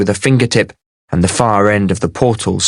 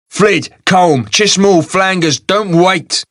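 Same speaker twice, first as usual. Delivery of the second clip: snapped; 9 semitones higher, slower than usual. A narrator's reading. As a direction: snap out the names like commands. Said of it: "snapped Agur".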